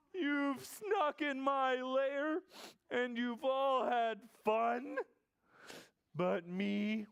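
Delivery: slow, loud wailing bear voice; upset bear. Acted. Bear who missed the party, slow wounded wail. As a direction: complain as a hurt bear, starting high and halting midway. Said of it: "Oh, Bear is sad".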